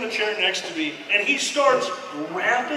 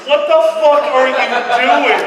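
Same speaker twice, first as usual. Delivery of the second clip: high, loud angry radio call; loud furious air traffic voice; radio controller yell; 4 semitones higher, 9 dB louder than usual. Retold or imitated. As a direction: yell at stoners over the radio. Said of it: "There's nothing but chatter".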